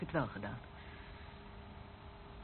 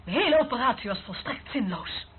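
a sharp, much louder and higher "Nee!" that then stays loud; furious shout; 5 semitones higher, 8 dB louder than usual. Acted. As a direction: explode in anger at the failed operation. Furious refusal in argument.

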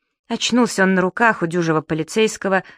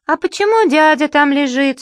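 put-on much higher, louder small child; child's voice; 9 semitones higher, 5 dB louder than usual. A small child asks mum about the man, curious child voice. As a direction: ask like a curious little kid. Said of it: "спросил детский голос".

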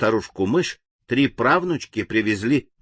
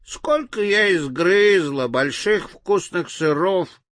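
high, slow toothless granny; sweet old lady; 6 semitones higher, slower than usual. A narrator's reading. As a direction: mumble proudly like an old granny. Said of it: "прошамкала старушка беззубым ртом".

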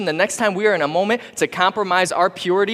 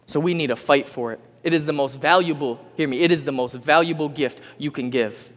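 usual speaking voice

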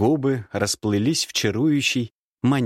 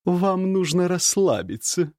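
higher, slow woman's voice; 9 semitones higher than usual. Acted. Nastasya voiced in story. (томный женский голос)

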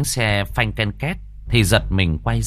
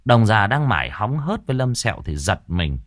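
plain reading voice

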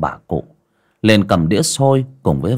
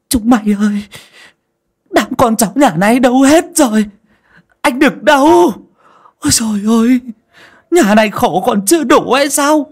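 high, loud panicked shrieking; terrified stammering cry; 7 dB louder than usual. A narrator's reading. Aunt finding the dead grandmother, shrieking for the family in terror. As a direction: shriek for help, stumbling over words in horror. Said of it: "kinh hãi lui ra ngoài ... mà lắp bắp".

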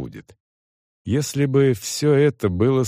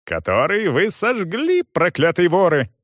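high-pitched bitter accusation; angry yelling; 8 semitones higher than usual. A narrator's reading. Young man blames them for the fire, furious. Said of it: "орал он".

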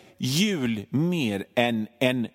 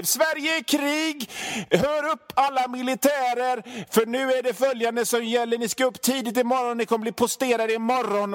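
mock military command; 9 semitones higher than usual. Reading out an online war alarm.

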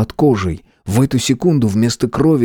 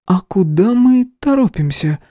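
high, playful sly question; 10 semitones higher than usual. Acted. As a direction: ask it slyly, teasing. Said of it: "лукаво спросила".

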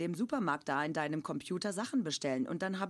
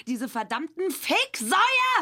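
louder, higher, slow raging curse; furious curse; 9 semitones higher, 8 dB louder, slower than usual. Acted. At home on the company outing, he rages at the fakes. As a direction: spit out the insult in comic rage. Comic rage outburst.

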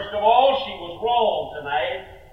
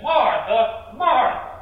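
MARTHA said twice, each loud and raised; emphatic name-calling; 5 semitones higher, 6 dB louder, slower than usual. Acted. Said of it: "to put emphasis there".

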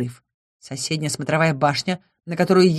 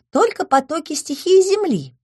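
nymph voice; 10 semitones higher than usual. A narrator's reading.

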